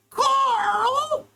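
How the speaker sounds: high, shrill cartoon scream of the name; screaming Carl. Shouted Jimmy Neutron quote.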